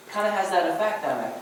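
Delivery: ordinary talk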